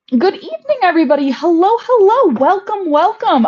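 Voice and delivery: bright, high welcome, sung out to the viewers; high sing-song greeting. Real, not acted. A vlogger cheerfully welcoming her live viewers, high and sing-song. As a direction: greet the live chat like a host.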